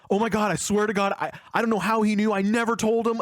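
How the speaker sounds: panicked denial. Retold or imitated. Amir swears to Robin he never told, panicked and high. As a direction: blurt it out in a panic, swearing innocence.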